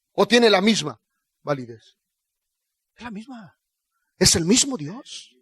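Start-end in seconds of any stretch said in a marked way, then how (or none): none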